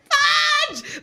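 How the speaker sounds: high, loud yelp; panicked exclamation shouted high and loud. Retold or imitated. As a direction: yelp it out in alarm.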